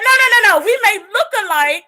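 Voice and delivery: put-on high, loud, laughing Woo Young; a hint of Woo Young, laughing hard; laughing quote. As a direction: squeal the protest through laughter. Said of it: "And Woo Young said".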